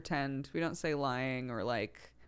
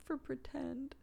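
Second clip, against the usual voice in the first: put-on high, soft child voice; little daughter's voice; 9 semitones higher, 10 dB quieter, slower than usual. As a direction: imitate the toddler admitting it sweetly, up high. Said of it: "sometimes she'll go".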